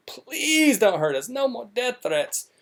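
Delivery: high, whiny, slow mock plea from a weepy wife; mock pleading wife. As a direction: squeak it out like a pampered wife begging for mercy. Mocks Brittany's plea again.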